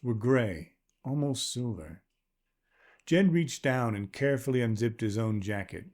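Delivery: ordinary delivery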